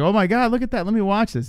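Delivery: high mock excited voice; imagined fighter reacting. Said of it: "He's like".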